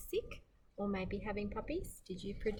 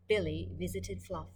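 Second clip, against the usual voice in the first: ordinary delivery, nothing marked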